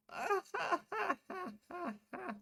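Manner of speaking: put-on high, cracked old crone chuckle; thin, high old woman's chuckle; old woman's laugh. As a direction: laugh to herself like an amused old lady. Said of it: "The old woman laughed to herself".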